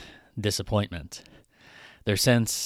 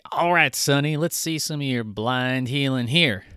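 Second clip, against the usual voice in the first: a mocking Southern accent, pitched higher; 4 semitones higher, 3 dB louder than usual. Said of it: "using a Southern accent to convey backwardness".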